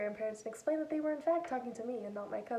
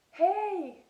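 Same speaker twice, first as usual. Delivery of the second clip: loud, much higher happy shout; excited greeting; 9 semitones higher, 9 dB louder than usual. Retold or imitated. Grandpa picking up his grandchild's call, loud and much higher. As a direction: greet the caller with big delight.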